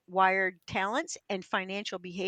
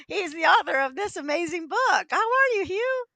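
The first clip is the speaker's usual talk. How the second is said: much higher, louder, bubbly "How are you, Hugh?"; delighted greeting; 8 semitones higher, 4 dB louder than usual. Real, not acted. Host greets guest with delight.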